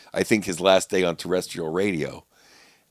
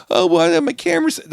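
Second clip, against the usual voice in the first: higher, louder mock objection; whiny put-on excuse, pitched way up; mock excuse-making comics; 13 semitones higher, 8 dB louder than usual. Imitating comics making excuses about taping sets, mock protest. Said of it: "people are like".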